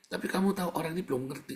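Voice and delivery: ordinary talk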